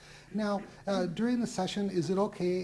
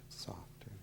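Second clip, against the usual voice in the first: whispered; 9 semitones lower, 15 dB quieter than usual. Acted. Hypnotist coaching the count.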